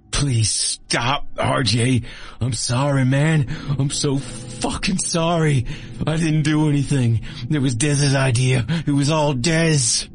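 a beaten man begging for his life; desperate pleading. Acted. Copperhead begs RJ to spare him, high and desperate. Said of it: "he pleaded".

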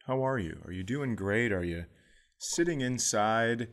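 ordinary talk

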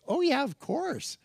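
high, casual, carefree answer; breezy yes. Retold or imitated. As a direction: toss it off lightly. Breezy answer to State Department.

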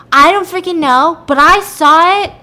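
loud, high mock outburst; acted exasperation. Woman questioned by police about witnesses, exasperated. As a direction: snap back in mock frustration.

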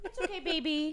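soothing pet. Real, not acted. Comforting the scared cat.

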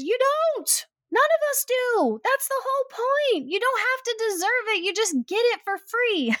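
very high, insistent acted-out voice; emphatic reassurance. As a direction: exclaim it high, as a friend setting her straight.

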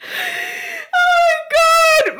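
very high, long drawn-out squeal; overcome, squealing delight; giddy exclamation. Real, not acted. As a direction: stretch it out slowly, way up high. Overcome reacting to own story.

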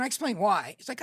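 ordinary talk

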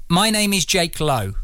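measured, word-by-word introduction; careful slow recital; slow and deliberate. Pretend. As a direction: recite it slowly into the microphone, as instructed. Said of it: "I want you to say it deliberately".

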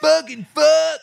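very high, strained furious yell; angry heckler. Retold or imitated. Angry fan cursing the announcer, high strained yell. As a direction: scream abuse like an enraged fan.

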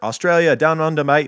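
loud, high hammed-up Australian "down under, mate"; mock Australian. Reading a fan review aloud in a loud hammed-up Australian voice. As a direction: boom it out like a cartoon Aussie.

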